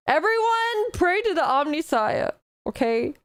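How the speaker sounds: high, excited call to pray; rally the viewers to prayer, hamming it up; mock rallying call.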